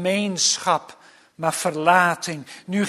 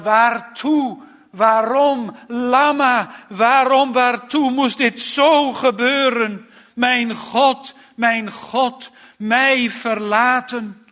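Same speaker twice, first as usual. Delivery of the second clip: hoge, luide kreet van ontzetting; uitroep van ontzetting; 7 semitones higher, 6 dB louder than usual. Retold or imitated. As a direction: roep de vertwijfelde vragen luid uit. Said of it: "dat is een uitroep van ontzetting". (ontzet uitroepen, met verheven preekstem)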